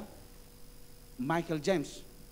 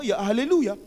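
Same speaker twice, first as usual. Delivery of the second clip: joyful praise; 4 semitones higher, 9 dB louder than usual. Real, not acted. Preacher exclaims praise.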